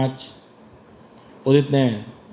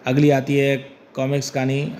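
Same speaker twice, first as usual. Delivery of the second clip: usual speaking voice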